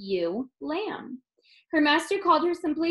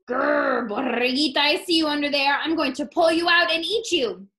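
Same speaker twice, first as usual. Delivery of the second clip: louder growl, menacing; growling coyote; 4 semitones higher, 5 dB louder than usual. Acted. Coyote threatening the hidden lamb, louder growl. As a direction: growl it, closing in. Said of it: "he growled".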